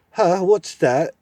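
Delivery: high, loud and slow cranky dwarf; deaf old dwarf. Acted. Deaf dwarf demanding the owl repeat itself.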